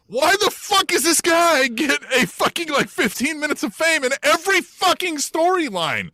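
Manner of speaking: high-pitched incredulous rant; exasperated bafflement. Real, not acted. Co-host baffled by Reginald in every storyline, exasperated bafflement. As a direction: demand an answer, pitch climbing in disbelief.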